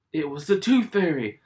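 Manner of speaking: usual speaking voice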